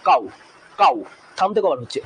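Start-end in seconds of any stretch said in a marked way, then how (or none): 0.1-0.9 "কাউ" said in one go, then a pause; one unbroken syllable. Shown as a demonstration. Teacher showing students one syllable, "কাউ" unbroken and set apart. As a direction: say "কাউ" as a single isolated beat. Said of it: "দুবার আমার বলতে হচ্ছে না".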